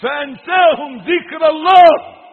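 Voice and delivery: high, very loud proclamation; impassioned preaching. Real, not acted. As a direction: thunder the verse out to the congregation. Preacher bursts out with verse. (وعظ جهوري حماسي)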